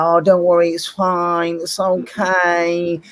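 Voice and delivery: high, slow, mock-sweet comforting girl voice; mocking girl mates. Mocking the girl mates soothing Bruno, high mock-sweet coo. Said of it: "her girl mates like".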